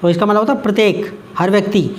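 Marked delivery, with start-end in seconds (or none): none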